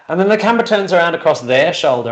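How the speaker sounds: ordinary talk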